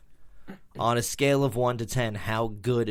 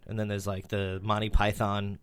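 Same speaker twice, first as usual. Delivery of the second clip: usual speaking voice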